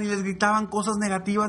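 usual speaking voice

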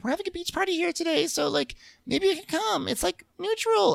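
very high, fast put-on voice; high squeaky mock cheer; imitating Ashley. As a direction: chirp the invitation. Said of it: "Ashley's like".

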